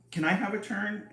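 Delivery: a tiny creature begging for a turn; small unicorn voice. Acted. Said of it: "asked the teeny weeny unicorn".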